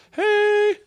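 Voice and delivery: very high, drawn-out mock "hey"; sing-out squeak imitating a whole country; comic high greeting. Comic voicing France in the war, high mock greeting.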